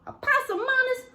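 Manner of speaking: put-on a mother calling out her child in alarm; scolding mama.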